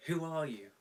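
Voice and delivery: a guard calling down from the wall. A narrator's reading. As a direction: call it out from a wall top. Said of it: "the guard called out".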